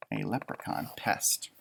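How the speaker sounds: breathy hushed whisper. Acted. Teacher whispering to kids about the pest. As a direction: whisper it like a secret.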